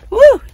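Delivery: high, loud woo of delight; a cheerful yell after hopping in; excited whoop. Real, not acted. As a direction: whoop as you land inside. Hopping into the van.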